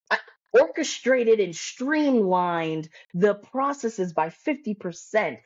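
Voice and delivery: slow, drawn-out jargon parody; mock résumé-speak. Coach reading a client's fluffy résumé, in mock résumé-speak. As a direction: stretch out each puffed-up buzzword. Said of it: "their resume speaks like this".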